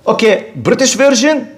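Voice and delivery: ordinary talk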